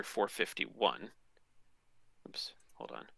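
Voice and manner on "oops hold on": hushed oops aside; whispering. Real, not acted. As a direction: breathe it under your breath. Quick aside while fixing a misclick.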